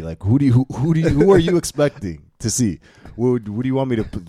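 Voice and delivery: incredulous comic rant; sarcastic challenge. Acted. Acted sarcastic challenge to stereotypers.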